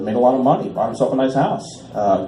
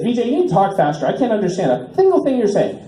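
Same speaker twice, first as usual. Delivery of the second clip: caller's complaint; 7 semitones higher than usual. Retold or imitated. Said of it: "he said".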